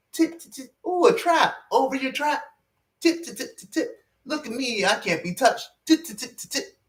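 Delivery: high, sneaky tiptoeing singsong; taunting wolf voice. Pretend. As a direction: tiptoe past the traps, gloating. Wolves tiptoeing past traps, taunting.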